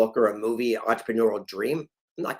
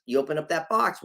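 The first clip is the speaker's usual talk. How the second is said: ordinary talk, nothing marked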